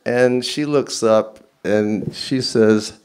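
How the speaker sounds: ordinary delivery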